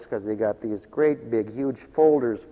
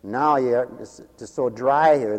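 usual speaking voice

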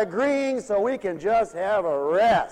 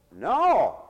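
normal talking voice

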